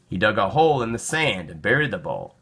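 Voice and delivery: usual speaking voice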